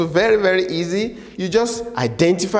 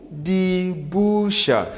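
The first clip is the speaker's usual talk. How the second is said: very slow die Bücher; slow drill; 2 semitones higher, much slower than usual. Shown as a demonstration. Teacher drills plural very slowly. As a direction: draw out die Bücher word by word. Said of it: "we pronounce the word".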